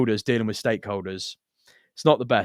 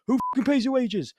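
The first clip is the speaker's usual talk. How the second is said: high, furious yelling; angry yell; 14 semitones higher than usual. Retold or imitated. Boss yelling at the lads on site, high furious. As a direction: tear into the lads.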